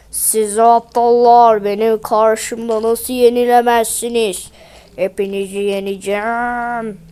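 slow, low, gloating boss; villain golem. Acted. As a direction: sneer at the fools below.

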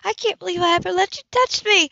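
high, loud angry shout at him; furious yell. Acted. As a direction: yell it in outrage. Heroine yells during scuffle.

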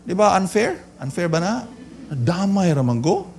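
ordinary talk, nothing marked

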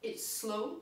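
ordinary talk, nothing marked

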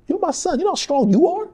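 high, pumped-up father; encouraging dad. Retold or imitated. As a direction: build him up. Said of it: "I said".

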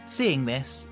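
usual speaking voice

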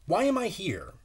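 ordinary delivery

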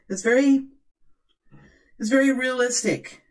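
lightly escalated sales tone. Shown as a demonstration. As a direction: let the voice climb into escalated energy. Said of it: "Now I'm raising my voice too".

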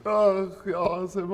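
drawn-out, self-pitying moan; tearful lament. Acted. Man tells his colleague he weeps over himself, tearful. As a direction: lament slowly, as if mildly weeping. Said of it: "عم ببكي على حالي".

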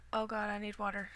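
usual speaking voice

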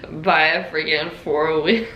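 real laughter breaking into the words; laughing. Real, not acted. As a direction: struggle to get it out through laughs. Laughing through sister's retold line.

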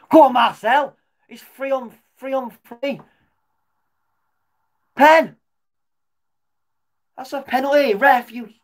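high, loud urging shout; real excited yelling. Real, not acted. Fan watching his team attack, high excited shouting. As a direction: scream him on, then demand the penalty.

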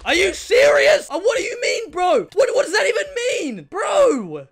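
high, loud yelling in disbelief; outraged shout. Real, not acted. Gamer robbed of his kill yells at Hypixel, outraged shouting. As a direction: shout at the screen, cheated.

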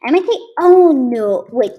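loud, high startled cry; alarmed. Real, not acted. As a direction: blurt it out in sudden alarm. Realizes a mistake in game.

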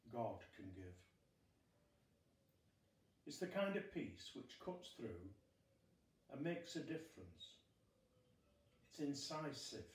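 normal talking voice

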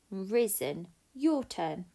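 normal talking voice

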